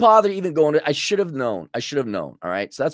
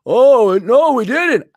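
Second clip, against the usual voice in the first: loud, high "Oh, no, we didn't"; childish worker; 8 semitones higher, 9 dB louder, much slower than usual. Retold or imitated. A supply store worker to the owner, in a loud, high, childish voice. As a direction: play a clueless kid caught out. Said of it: "dealing with children".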